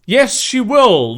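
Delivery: slow, high, loud cheer; acted rallying cry. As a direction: stretch it out in loud support. Ally cheers the heroine.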